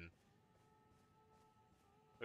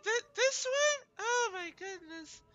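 very high breathy mock girly voice; squeaky over-the-top girl impression; horrible girl voice; 3 dB louder than usual. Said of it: "with a horrible Aaron Hansen like girl voice".

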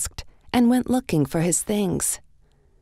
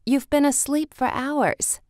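higher, lightly voiced big sister, gently teasing; sister's voice; 9 semitones higher than usual. A narrator's reading. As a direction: tell her she slept so long. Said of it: "Alice's sister said".

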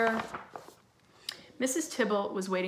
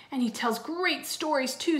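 lightly voiced child, pitched up; higher, keen kid voice; eager little boy; 7 semitones higher than usual. A narrator's reading. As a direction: chime in with admiration. Twin praising babysitter.

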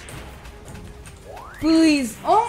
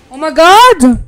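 loud shout; 17 dB louder, slower than usual. Real, not acted. Huge hit in boss fight.

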